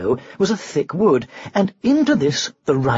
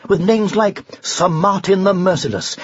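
usual speaking voice

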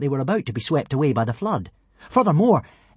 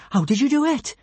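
amazed question; 12 semitones higher than usual. A narrator's reading. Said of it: "said Kamikaze".

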